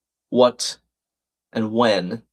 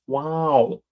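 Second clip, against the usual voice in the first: Owen Wilson impression; 3 semitones higher, 3 dB quieter than usual. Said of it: "Owen Wilson impressions".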